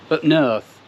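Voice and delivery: mock Yorkshire, leaning on "north"; jokey fake northern accent; bad northern accent. Shown as a demonstration. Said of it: "my fantastic accent".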